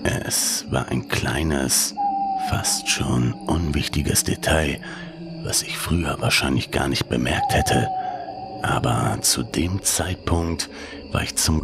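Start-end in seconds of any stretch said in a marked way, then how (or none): none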